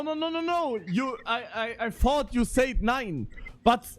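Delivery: ordinary talk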